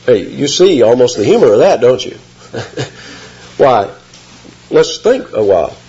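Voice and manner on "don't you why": usual speaking voice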